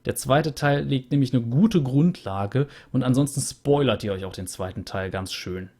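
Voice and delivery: ordinary talk, nothing marked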